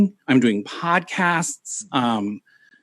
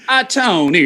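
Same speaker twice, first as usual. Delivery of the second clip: mock call; 8 semitones higher than usual. Shown as a demonstration. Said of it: "I always have to do this with my finger".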